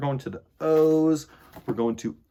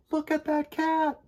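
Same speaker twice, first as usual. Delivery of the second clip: high, drawn-out "Look at that cat"; high slow exclaim. Acted. Teacher showing kids the picture, high slow exclaiming. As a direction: marvel at the cat, slowly and high. Pitched-up repeat.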